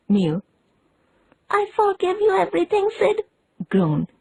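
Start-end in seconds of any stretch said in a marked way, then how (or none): 1.5-3.2 high, quick, melodramatic forgiveness; hammed-up deathbed boy. A narrator's reading. Tom to Sid, high mock-dying farewell. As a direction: play the martyr to the hilt.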